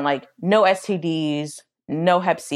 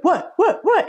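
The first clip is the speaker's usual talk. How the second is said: called-back reply; 12 semitones higher, slower than usual. Retold or imitated. Dad woken from his nap, calling down. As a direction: shout back down the stairs.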